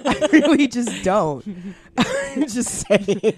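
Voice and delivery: laughing through the words; giggling while talking. Real, not acted. Laughing at own rant.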